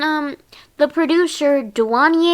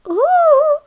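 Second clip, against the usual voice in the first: sudden high sung ooh, breaking off; almost singing; 6 dB louder than usual. Real, not acted. Vlogger catches herself starting to sing, high crooned ooh. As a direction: slip into a sung note then stop. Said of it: "I kinda almost started singing there".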